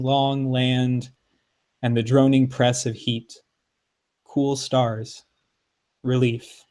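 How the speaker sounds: measured, deliberate poetry reading, lingering on each state motto; poem reading. Acted. Poet reading his poem to the podcast co-host.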